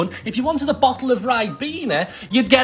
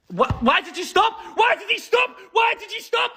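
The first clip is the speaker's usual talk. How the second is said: high-pitched, exasperated repeated demand; frustrated outcry; 10 semitones higher than usual. Pretend. Player yelling at the thing that stopped, exasperated outcry. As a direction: wail the question, climbing higher.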